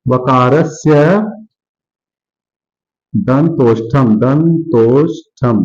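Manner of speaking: वकारस्य दंतोष्ठम said slowly, दंतोष्ठम stretched out; drawn-out recitation. Shown as a demonstration. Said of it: "देखिए". (धीमे, खींचकर सूत्र बोलना)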